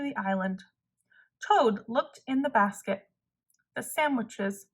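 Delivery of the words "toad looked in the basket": plain reading voice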